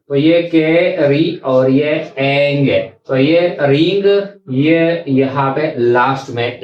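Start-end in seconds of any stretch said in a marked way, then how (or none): 0.1-2.9 केरी and एंग said slowly, apart; slow syllable split. Shown as a demonstration. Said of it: "यहाँ पर है केरिंग". (धीरे-धीरे शब्दांश अलग)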